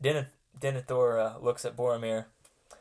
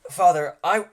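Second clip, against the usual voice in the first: urgent plea; 6 semitones higher, 10 dB louder than usual. Acted. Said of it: "Boromir steps up and says".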